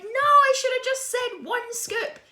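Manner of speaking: high, loud, drawn-out mock regret; anguished wail. Child regretting the answer as pie piles up, anguished wail. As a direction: wail it in hammed-up despair.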